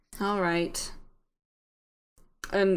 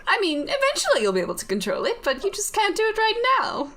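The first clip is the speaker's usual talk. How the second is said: higher, swooping put-on British reassurance; bad British accent; 8 semitones higher, faster than usual. Voice in the head reassures the confused character, lilting British accent. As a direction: soothe them in a hammy, lilting British voice. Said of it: "My horrible British accent".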